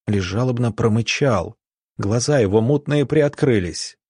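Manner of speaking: ordinary delivery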